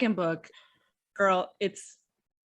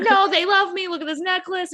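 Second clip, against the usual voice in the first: mock defiant; 9 semitones higher than usual. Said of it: "and she's like".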